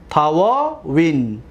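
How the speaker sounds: teacher drawing out تواوین so students hear the long a; slow, spelled-out تواوین. Shown as a demonstration. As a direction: stretch تواوین out slowly, every syllable clear. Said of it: "دقت دادید؟".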